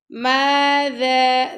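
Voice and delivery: ماذا with the ذ said softly, not hard; soft dhal. Shown as a demonstration. Teacher showing a soft dhal in ماذا, drawn out. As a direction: ease gently through the ذ in ماذا. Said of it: "ذال کو بھی نرمی سے پڑھیں گے".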